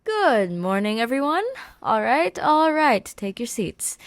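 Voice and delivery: bright, lively teacher greeting; lightly voiced cheerful teacher. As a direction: call the class to order.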